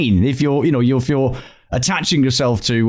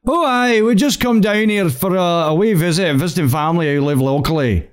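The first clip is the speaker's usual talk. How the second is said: bad Scottish accent, starting high; fake Scottish accent; 5 semitones higher than usual. Shown as a demonstration. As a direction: bluff your way through in put-on Scottish. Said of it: "having to maintain it and just, you know, saying".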